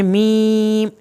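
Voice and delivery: "میم" with the long i held level; obligatory madd. Shown as a demonstration. Teacher telling students to lengthen it, elongated "میم". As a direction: stretch "میم" slowly. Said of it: "اس کی مد واجب پڑھنی ہے".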